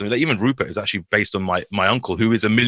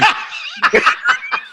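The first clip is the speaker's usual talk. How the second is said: breathy high laugh. Real, not acted. Laughing after impression.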